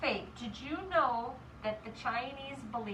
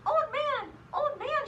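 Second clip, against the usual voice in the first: put-on high child's call; mildly shouted storybook cry; shouting; 10 semitones higher, 3 dB louder than usual. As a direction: call out high to rouse someone. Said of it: "shouted Chang".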